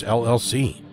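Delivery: usual speaking voice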